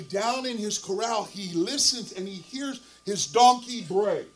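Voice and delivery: ordinary talk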